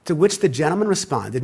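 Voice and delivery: usual speaking voice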